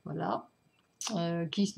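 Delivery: normal talking voice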